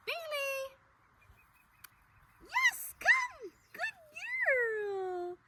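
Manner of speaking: high, loud, happy call to the dog; excited recall call. Shown as a demonstration. Trainer calling her puppy back, high and excited. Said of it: "So I say here".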